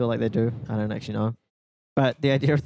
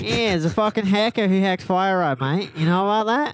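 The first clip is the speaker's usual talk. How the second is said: high nasal matey Aussie; mock Australian accent; 4 semitones higher than usual. Telling mate about the hacker, put-on Australian accent. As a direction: tell it as a pinched, broad Australian. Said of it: "My nose is sore now".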